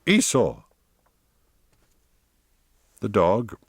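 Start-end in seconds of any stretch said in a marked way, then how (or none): 0.0-0.6 higher-pitched order to a dog; commanding call, pitched up. A narrator's reading. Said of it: "he commanded".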